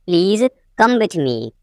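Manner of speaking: teacher setting "Please" apart; broken-up repeat. Shown as a demonstration. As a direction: pause after "Please".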